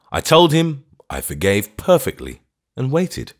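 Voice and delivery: usual speaking voice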